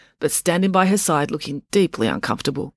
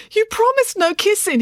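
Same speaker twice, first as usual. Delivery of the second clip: much higher, panicked pleading man; 4 dB louder than usual. Acted. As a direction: beg her in a high panicked voice. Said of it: "he pleaded".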